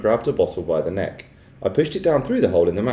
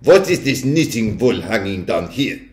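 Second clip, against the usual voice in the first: slow witch voice with a put-on accent. A narrator's reading. Witch finding knitting wool on her balcony, suspicious. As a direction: demand it slowly, as a suspicious witch. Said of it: "I heard her saying".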